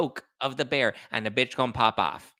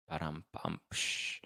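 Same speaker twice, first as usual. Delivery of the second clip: mock rimshot; 7 semitones lower, 13 dB quieter, slower than usual. Rimshot after a joke.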